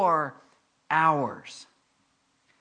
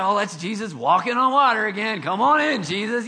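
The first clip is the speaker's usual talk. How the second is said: loud, higher-pitched mock hail; mock casual greeting; 5 semitones higher, 7 dB louder than usual. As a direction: call out as if it happens every day. Mock disciples greeting Jesus casually.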